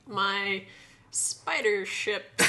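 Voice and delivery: slow, hesitant and drawn out; hesitant quote. Retold or imitated. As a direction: pause before spider, then drag it. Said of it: "the hesitation when she's like".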